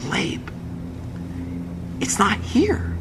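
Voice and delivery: hushed, halting whisper. Retold or imitated. Wife telling her husband the paper is missing, whispering. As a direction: whisper bad news with a long pause after the name. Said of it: "Hannah whispers to me".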